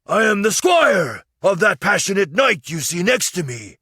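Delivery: high, loud, overblown squire's address; high shouting flattery; grand proclamation. Acted. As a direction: kneel and proclaim to the queen. Kneeling squire's grand speech.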